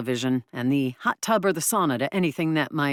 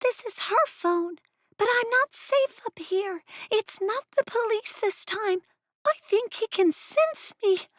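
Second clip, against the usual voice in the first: high, hushed, scared voice; frightened goblin whisper. Acted. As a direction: whisper in hiding, afraid. Tinja hiding, frightened.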